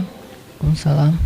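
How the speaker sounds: low, soft and slow, answering reluctantly; sulky reply. Retold or imitated. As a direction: mutter the greeting back, slow and sulking. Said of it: "Kayak ngambek gitu kan". (jawab salam pelan dan ogah-ogahan)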